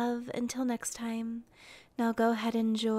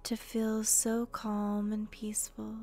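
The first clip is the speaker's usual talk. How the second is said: guided meditation; 4 dB quieter than usual. Acted.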